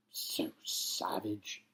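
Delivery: slow whisper, drawn out; whispered aside. Acted. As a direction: whisper it to yourself, appalled. Captain's aside about alien.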